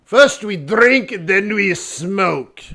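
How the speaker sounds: loud, drawn-out caricature of European leaders; high, booming mock-European pronouncement. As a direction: chant it slowly like a boozy summit motto. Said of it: "all the leaders in Europe".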